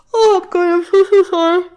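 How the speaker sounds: high, loud cry of embarrassment; loud embarrassed apology. Real, not acted. Gamer apologising to viewers for yawning, loudly flustered. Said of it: "my apologies for my yawning".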